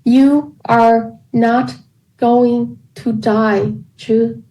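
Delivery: very slow, every word weighted apart; slow, deliberate doctor's reassurance; strong emphasis on each word. A narrator's reading. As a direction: lean on each word of "You are not going to die". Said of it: "with strong emphasis between each word".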